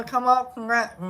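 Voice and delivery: ordinary talk, nothing marked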